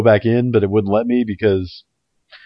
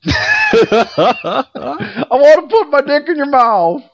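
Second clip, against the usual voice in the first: laughing mock; much slower than usual. Repeating the stranger's line.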